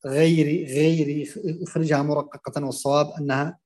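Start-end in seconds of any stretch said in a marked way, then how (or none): 0.1-1.2 غيري with a light, thin ghayn. Shown as a demonstration. Teacher demos a wrong thin ghayn in غيري. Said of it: "ترقيق الغين".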